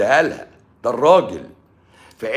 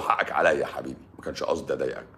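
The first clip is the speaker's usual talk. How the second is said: soft pleading wife; 12 semitones lower, 9 dB quieter, faster than usual. Retold or imitated. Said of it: "وتقول له".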